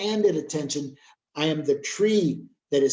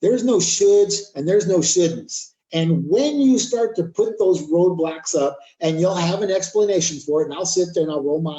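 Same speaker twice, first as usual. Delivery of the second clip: forceful preaching; 4 semitones higher, 7 dB louder than usual. Acted. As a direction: preach it hard at the crowd, louder.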